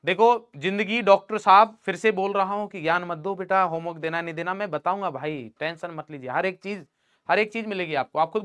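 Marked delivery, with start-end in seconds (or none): none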